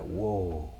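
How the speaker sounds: soft, retold "whoa"; understated, quiet wonder; hushed awe. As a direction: let it out quietly, recalling amazement. Retold awe after weight lifts.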